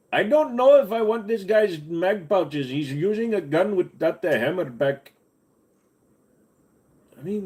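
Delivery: high, put-on Indian accent; playful Indian accent voice. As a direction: ham up an Indian accent for chat. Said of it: "I am doing the accent".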